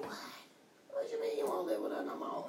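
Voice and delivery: shocked, breathless whisper; a hushed, disbelieving gasp of a question; stunned whisper. Retold or imitated. Shocked reaction to daughter, whispered.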